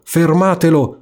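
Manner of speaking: the rat's cry builds to its peak; yelling rat. Acted. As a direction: rat yelling at the straws to stop the boat. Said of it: "come gridava".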